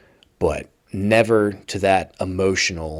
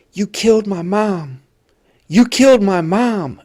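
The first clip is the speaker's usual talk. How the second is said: high, anguished yell, the second louder; retold yelling; 12 semitones higher, 6 dB louder than usual. Inmate yelling at his mother's killer, high anguished cry. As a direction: cry out the accusation from a raw wound. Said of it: "he starts yelling at him".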